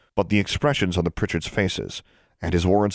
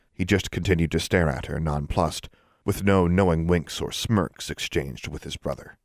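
plain reading voice

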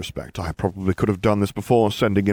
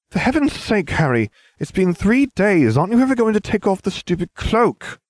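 much higher, exasperated schoolgirl voice; exasperated Hermione; 13 semitones higher, 4 dB louder than usual. A narrator's reading. Hermione scolds Harry over the cloak, exasperated. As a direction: groan it out in frustration. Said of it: "Hermione groaned".

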